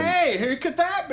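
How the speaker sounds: hamming up the innocent question; mock innocent. Podcast host teasing her co-host about covering the event, high mock-innocent voice.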